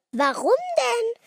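very high, loud questioning; puzzled protest. A narrator's reading. Girl hearing of only one present, surprised question. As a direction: ask it high and insistent.

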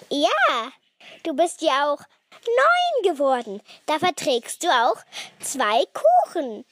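high, loud, jolly delivery; merry teasing. A narrator's reading. Brother joking about two cakes, merry and loud. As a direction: tease her cheerfully, without laughing. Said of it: "lachte Max".

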